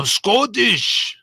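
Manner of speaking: hammed-up Scottish impression; Fat Bastard. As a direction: drawl it like a cartoon Scot. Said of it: "Fat Bastard".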